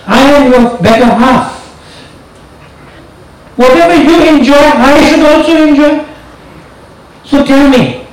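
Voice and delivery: a hint of a coaxing wife; lightly voiced Parvati. Parvati pleading with Shiva.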